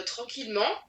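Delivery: ordinary talk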